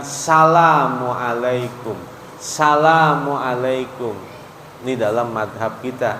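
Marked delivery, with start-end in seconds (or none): 0.0-4.2 slow "Salamualaikum" starting straight on sa; salam without "as". Shown as a demonstration. Ustadz showing what not to say, "Salamualaikum" without as-. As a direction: stretch "Salamualaikum", no as- before it.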